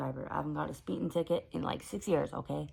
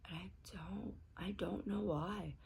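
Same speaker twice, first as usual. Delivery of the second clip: soft, halting, close to crying; real holding back of tears, hushed; near tears; 3 semitones higher, 8 dB quieter than usual. Real, not acted. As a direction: voice sinks as tears threaten. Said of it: "I just kinda wanna bust into tears".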